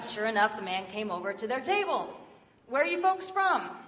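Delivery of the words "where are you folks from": higher, chatty friendly stranger; raised, sociable voice. Retold or imitated. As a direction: greet the visitors with friendly interest. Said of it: "asked in a friendly voice".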